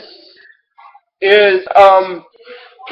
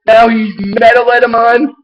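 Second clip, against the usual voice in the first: ordinary talk, nothing marked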